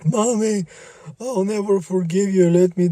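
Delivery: put-on high, eerie child voice; child ghost.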